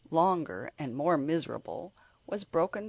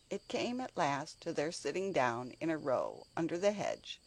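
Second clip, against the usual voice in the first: ordinary delivery, nothing marked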